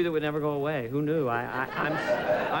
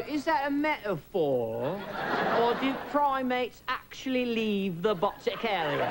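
drawn-out mock British intellectual; intellectual British journalist; 3 semitones higher, slower than usual. British press queries a crude joke, intellectual British accent. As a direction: ask it slowly, in a learned British accent. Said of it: "these very intellectual British people were going".

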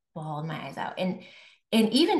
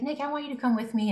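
sister pleading; 6 semitones higher, faster than usual. Retold or imitated. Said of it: "she's like".

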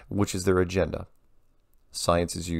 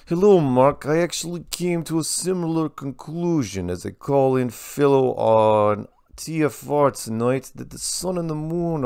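higher-pitched put-on Russian accent; 6 semitones higher than usual. Host reading a Soviet Bloc caller's email, Russian accent. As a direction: read it out in a singsong, high Russian accent. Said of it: "I can't do a female Russian accent".

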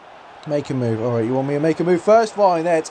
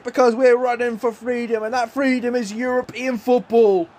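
normal talking voice

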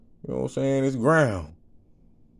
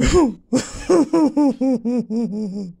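long high helpless laugh; 12 semitones higher, 5 dB louder than usual. Real, not acted. Can't stop laughing at situation.

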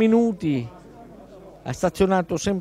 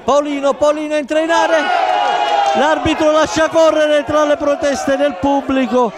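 excited commentary; 6 semitones higher, 5 dB louder than usual. Real, not acted. Live attack on goal.

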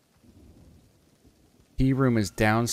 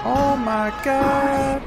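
drawn-out shock; 5 dB louder, much slower than usual. Real, not acted.

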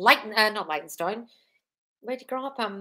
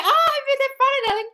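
much higher, sing-song "darling"; gushing delight; 5 dB louder than usual. Real, not acted. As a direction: coo the birthday wish. Greets a viewer's birthday.

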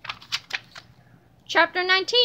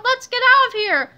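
high, loud, hurried plea; urgent cry; 7 semitones higher, 5 dB louder than usual. A narrator's reading. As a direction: call out to flee. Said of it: "cried Harold".

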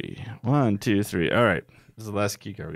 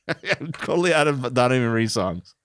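ordinary talk, nothing marked

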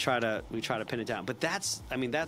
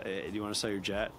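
bored dull pitch; 4 semitones lower, 5 dB quieter than usual. Shown as a demonstration. Sales rep makes a lazy call, lower and bored. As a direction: lower and softer, bored and uninterested. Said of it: "that's a waste of time".

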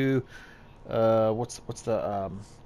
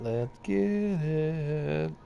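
drawn-out hype; 3 semitones higher, 4 dB quieter, much slower than usual. Real, not acted. Streamer hyping himself before the race, slow stretched-out chant. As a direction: stretch every word out very slowly.

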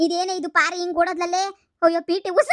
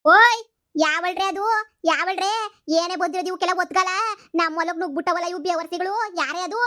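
put-on angry old grandmother; 5 semitones higher than usual. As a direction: drag out the call like a cranky granny. Old landowner woman catches the pickers.

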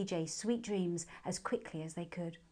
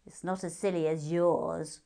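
sulky hare retort; 8 dB louder, slower than usual. A narrator's reading.

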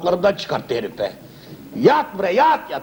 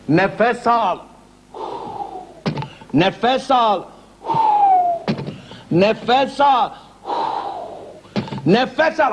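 each nefes al pushed higher; rising insistence; 6 semitones higher, much slower than usual. Acted. Doctor pressing patient to breathe, growing higher. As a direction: climb with every repeat.

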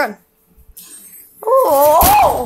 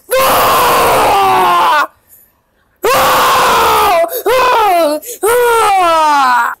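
rising panicked screams; loud screams; 3 semitones higher, much slower than usual. Acted. Player promised to scream if bad, then loud screams. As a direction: scream as loud as you can at the sight. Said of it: "I'm gonna scream really loud".

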